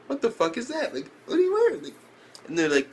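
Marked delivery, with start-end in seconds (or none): 0.1-1.8 mock shocked.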